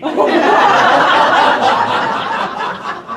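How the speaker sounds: high, loud wheezing laugh; breathy loud laughter. Real, not acted. Teller laughs at KFC joke.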